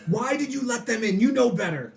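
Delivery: high, indignant telling-off; yelling scolding. Acted. Villain berating his guard, high angry scolding. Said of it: "He yells at everybody".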